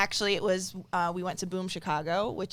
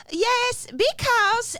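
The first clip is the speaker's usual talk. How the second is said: put-on high-pitched Dutch accent; 8 dB louder, much slower than usual. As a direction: imitate a Dutch comedian character. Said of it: "doing this Dutch accent".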